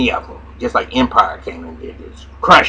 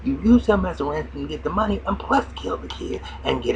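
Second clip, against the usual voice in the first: higher put-on woman's voice laying out the plan; scheming woman; 9 semitones higher than usual. Retelling the show, he voices the woman scolding Slim in a high pitch. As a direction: pitch her up high and scornful. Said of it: "she said".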